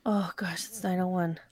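hushed aside; whisper. Real, not acted. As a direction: whisper it to yourself. Noticing the time.

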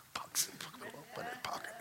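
breathy hush over the pocketed cash; sneaky whisper. Retold or imitated. Builder slipping money into his pocket, whispered. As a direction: whisper it like hiding loot.

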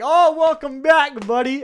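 high, loud, slow welcome; mock chummy greeting. Classmates greeting him back at school, high and loud. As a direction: crow it out like a jock slapping your back. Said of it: "They were like".

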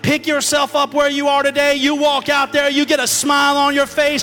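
loud rising sermon, slowing on the key line; full-force preaching. Acted. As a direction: preach it to the back row.

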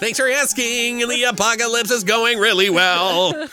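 high, slow sarcastic parroting; mock her dry reply, up high; mocking echo.

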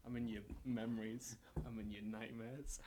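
low, hushed ominous poetry; mock poetic. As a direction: intone it quietly like a dark poet. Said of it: "gonna go real poetic".

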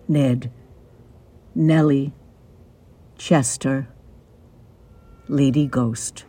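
ordinary delivery

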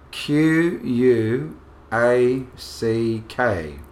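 slow, letter by letter; spelling it out. Shown as a demonstration. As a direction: spell each letter out slowly. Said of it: "Can you spell it?".

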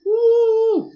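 high loud whoop; excited whoop. Real, not acted. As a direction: cheer it out. Cheering the homemade beer.

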